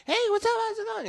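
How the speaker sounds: very high, bright-eyed phone greeting; bubbly customer. Retold or imitated. Cheerful customer answering his sales call, very high and excited. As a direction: gush hello like she's thrilled to hear you. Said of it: "I can feel the energy".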